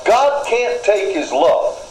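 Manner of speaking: ordinary talk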